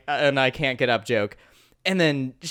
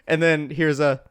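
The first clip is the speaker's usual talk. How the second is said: normal talking voice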